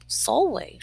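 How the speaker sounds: plain reading voice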